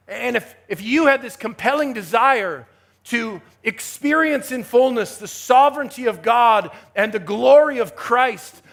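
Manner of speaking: fervent preacher hammering key words; impassioned preaching. Real, not acted. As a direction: drive it home to the congregation with swelling force. Preaches at full force.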